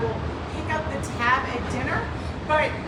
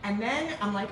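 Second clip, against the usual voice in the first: ordinary talk, nothing marked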